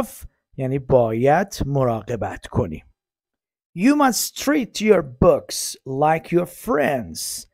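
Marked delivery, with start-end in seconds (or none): 3.8-7.5 slow, emphatic model sentence. Shown as a demonstration. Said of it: "slow model".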